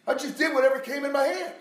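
high, loud preaching; impassioned testimony. Real, not acted. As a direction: confess it loudly to the congregation.

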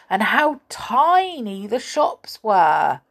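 ordinary delivery

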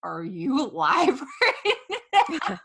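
put-on slow, laughing question from the boyfriend; mock him laughing down the phone. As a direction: boyfriend on speakerphone, laughing as he asks. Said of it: "all I hear is Brandon".

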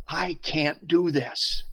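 slow, tearful imitation of sobbing; drawn out like a man in tears at the altar; bawling. Pastor at the altar to the bishop, slow tearful plea. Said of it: "And I'm just bawling".

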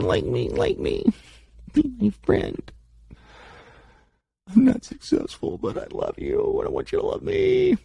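put-on high, pleading child voice; insecure child. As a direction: beg like an insecure child for love. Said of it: "an insecure child".

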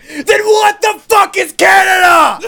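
high, slow, hammed-up yell; loud mock outrage; exasperated scream. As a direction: scream it in total comic bafflement. Acted outraged climax of moose argument.

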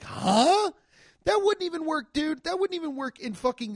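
stunned, incredulous high protest. Real, not acted. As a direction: squeak out your disbelief. Disbelief at sheriff scene.

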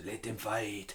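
bad put-on Japanese accent; hammy Japanese movie accent. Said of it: "sorry for the really bad generic racist Japanese accent".

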